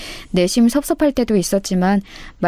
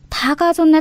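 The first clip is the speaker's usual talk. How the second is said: envious jab; 7 semitones higher than usual. Acted. Sister-in-law envying.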